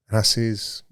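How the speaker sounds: normal talking voice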